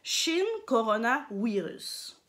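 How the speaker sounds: Shin Corona Virus, slowly, "Virus" as "wi-rus"; "Virus" opened on a W sound; Japanese pronunciation. Shown as a demonstration. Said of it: "ici au Japon, on dit".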